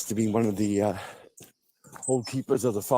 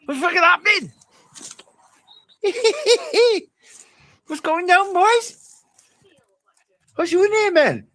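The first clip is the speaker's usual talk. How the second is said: much higher, louder squeaky cartoon voice; squeaky silly voice; 6 dB louder than usual. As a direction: ham it up as a squeaky cheeky lad.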